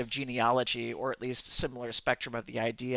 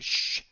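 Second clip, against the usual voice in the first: long breathy shush; hushing shh. Acted. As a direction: shush them to keep a secret. Character asks for secrecy.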